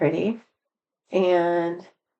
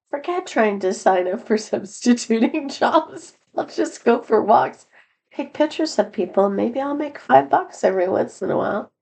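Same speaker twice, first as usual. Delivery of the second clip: amused inner thought; 6 semitones higher than usual. Retold or imitated. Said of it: "and I was thinking".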